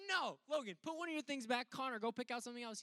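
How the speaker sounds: high, loud scolding parent; stern parents. Retold or imitated. Parents scold Logan at the counter, loud and high. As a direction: scold the greedy kid. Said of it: "my parents are like".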